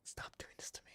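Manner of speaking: breathless whispered plea. Real, not acted. Gamer begging the sad story to stop, whispered. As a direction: whisper it, barely there. Pleading with the game.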